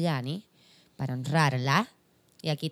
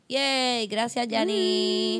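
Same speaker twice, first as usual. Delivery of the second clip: excited; 5 semitones higher, much slower than usual. Real, not acted. Cheerful thanks.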